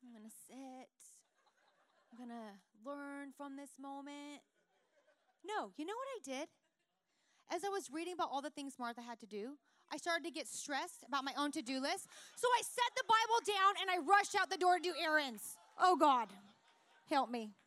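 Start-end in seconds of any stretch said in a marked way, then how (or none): 0.0-4.4 soft, devout, serene reply; reading the Bible, answering the Holy Spirit in a soft voice; pious calm. Retold or imitated. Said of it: "and I'm like". 12.3-17.5 loud, hurried exasperation; confessing to the congregation, loud and frantic. Acted. Comic confession of rushing out.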